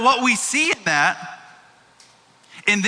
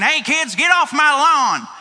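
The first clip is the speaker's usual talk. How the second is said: higher-pitched mock old-man holler; grumpy old man; 6 semitones higher, slower than usual. Old man on front porch yells at kids, grumpily. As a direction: act the porch grouch, drawing out the complaint. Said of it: "the proverbial old man who's sitting on the front porch".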